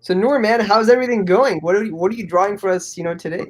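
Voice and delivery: ordinary talk, nothing marked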